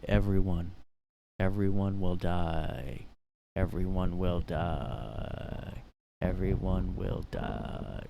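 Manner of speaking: slow, menacing demon chant; slow demonic threat. Pretend. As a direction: drag out each word like a demon.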